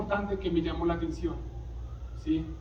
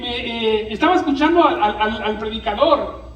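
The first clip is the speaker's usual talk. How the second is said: louder, higher, fast fiery preaching; full-force preaching; 5 semitones higher, 7 dB louder than usual. Acted. Preacher to congregation over music, preaching at full force. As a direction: fire up the congregation.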